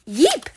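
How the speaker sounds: high, loud startled yeep; startled cry. A narrator's reading. Startled as others thud down, high cry. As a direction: squeak out a short cry of alarm. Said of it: "she cried".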